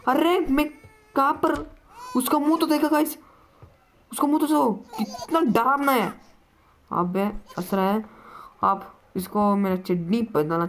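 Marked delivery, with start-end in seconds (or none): 0.1-6.1 high-pitched frightened babble; terrified. Real, not acted. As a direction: squeal at the monster's face. Sees the monster's face.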